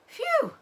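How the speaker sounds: high loud sigh of relief; relieved phew. A narrator's reading. Father fox after escaping the shovels, loud relieved phew. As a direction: let out a big relieved phew. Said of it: "said Mister Fox".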